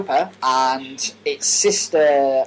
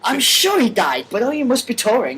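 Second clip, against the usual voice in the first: mock confused fan; 8 semitones higher, 4 dB louder than usual. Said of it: "didn't learn your lesson".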